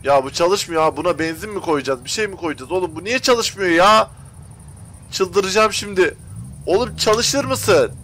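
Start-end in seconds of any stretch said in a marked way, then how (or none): 2.7-7.9 higher and louder, dragging the words out slowly; losing patience with a game that won't work; frustrated outburst. Real, not acted. Bus engine won't start.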